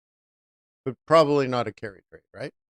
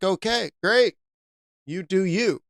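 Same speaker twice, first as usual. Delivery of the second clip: dismissive sarcasm; 5 semitones higher than usual. Acted. Dismissing viewer's moving average point.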